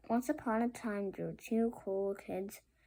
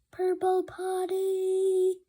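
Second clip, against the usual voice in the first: much higher, louder, slow reveal of Purple potty; grand announcement; 10 semitones higher, 5 dB louder, much slower than usual. Acted. Showing off the time machine.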